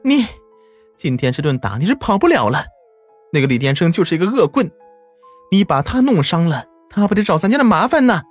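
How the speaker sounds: yelling. A narrator's reading. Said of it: "朝我吼道".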